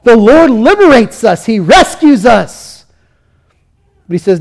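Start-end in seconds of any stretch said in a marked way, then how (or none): 0.0-2.9 louder, exultant cry of praise; mock rejoicing. People hearing the name Pashur, joyful shout. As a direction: shout it like the freed crowd. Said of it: "they thought".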